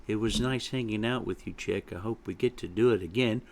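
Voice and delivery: soft, quick goodbye; subdued young chick. Acted. Chick bids his friend goodbye, subdued. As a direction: say farewell quietly and hurriedly.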